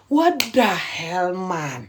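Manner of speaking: very slow, drawn-out exasperated sigh of words; real exasperation. Real, not acted. Speaker reacts to women being mistreated, exasperated. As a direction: stretch each word, sinking in disbelief.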